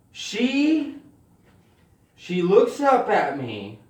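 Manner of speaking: ordinary talk, nothing marked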